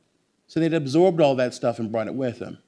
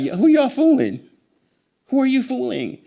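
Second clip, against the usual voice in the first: much higher, louder incredulous call-out; mock disbelief; 12 semitones higher, 6 dB louder than usual. Scoffing at Hollywood's fake accents, high and loud mock disbelief. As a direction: throw it out like you can't believe the nerve. Incredulous retold reaction to movie.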